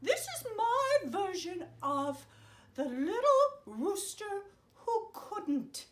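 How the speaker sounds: high, cheerful fairy godmother; a chirpy magical helper greeting kids. Acted.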